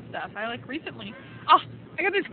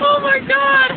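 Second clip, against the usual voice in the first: high, loud delighted exclamation; real excited shout; 13 semitones higher, 13 dB louder, slower than usual. Real, not acted. Watching the wind outside, loud excited shout. Reacting live to a scene.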